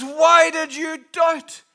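high, loud, slow challenge; emphatic rebuke. Acted. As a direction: draw out the reproachful question forcefully. Voices Jesus rebuking Peter.

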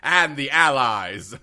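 put-on loud, drawn-out old-timey proclamation; mock old-timey announcer. Co-host chiming in on the bit, mock old-timey voice. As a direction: boom it out slowly like a wartime newsreel.

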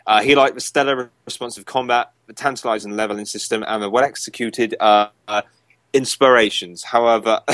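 normal talking voice

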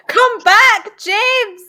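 loud playful call across the room. Real, not acted. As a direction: shout after him to come back. Calling after James who left.

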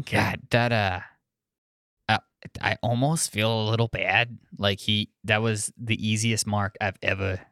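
low, gruff New York goblin. Acted. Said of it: "Fuck this voice".

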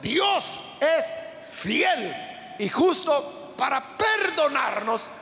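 slow, drawn-out pulpit emphasis; slow preacher's climax; fervent preaching. Acted. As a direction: preach it, stretching each phrase. Preaching the closing point.

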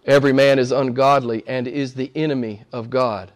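ordinary delivery

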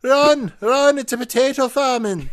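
high, loud cartoon Irish cry; panicked mock-Irish shout; Irish accent. Shown as a demonstration. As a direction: yell in a hammed-up Irish accent. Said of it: "of the Irish touchstone".

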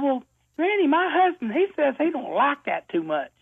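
put-on a naive young lady chirping back; young girl voice. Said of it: "She said".

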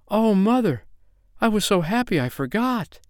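put-on high, plaintive child voice; abashed little girl. A narrator's reading. Girl confesses to mother she forgot thanks, abashed. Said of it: "hanging her head".

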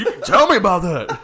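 put-on old wrestling host. Imitating a wrestling legend grilling a guest, hammed up. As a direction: ham up a cranky old interviewer.

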